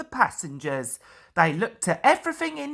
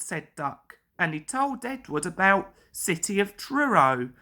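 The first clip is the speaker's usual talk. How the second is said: ordinary delivery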